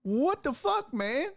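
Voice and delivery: high-pitched disbelieving outburst; incredulous outburst. Acted. Motorist hearing the driver claim the call, incredulous. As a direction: react as if you can't believe what you heard.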